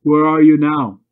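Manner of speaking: shouting into thick fog; loud searching shout; loud call. A narrator's reading. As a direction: call out loudly to find her. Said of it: "he called again".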